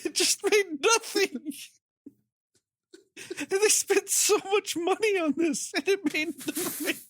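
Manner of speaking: high, squeaky words broken by real laughter; can barely get it out for laughing; laughing through words. Real, not acted. Telling friends about the flop, laughing hard through it.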